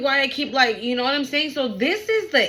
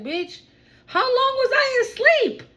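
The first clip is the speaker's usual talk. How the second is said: high startled squeal of disbelief; shocked wake-up; 14 semitones higher than usual. Pretend. Waking to a flood of followers, shocked high squeal. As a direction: act like you just woke to huge news. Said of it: "You're like".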